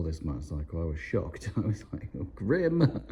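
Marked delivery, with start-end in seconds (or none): 2.2-3.0 high pitched mock dismay; disgusted. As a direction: recoil at an ugly bike. Reacting to ugly bike.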